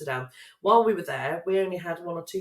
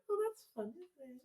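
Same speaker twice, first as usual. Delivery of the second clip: polite fake enthusiasm; 7 semitones higher, 12 dB quieter than usual. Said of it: "they go".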